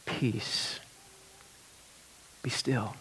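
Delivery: soft, hushed, calm command. Retold or imitated. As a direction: still the sea gently. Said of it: "said to the sea".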